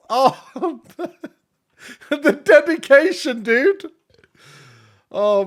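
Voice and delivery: high, slow laugh through the words; delighted laughing disbelief. Real, not acted. Streamer laughing at a viewer's dedication, high and amused.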